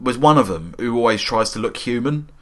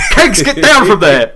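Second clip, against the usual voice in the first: loud, slow, laughing telling-off; teasing a friend by scolding his imaginary keg friend; mock scolding; 8 semitones higher, 7 dB louder, slower than usual. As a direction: shoo the imaginary friend down, giggling.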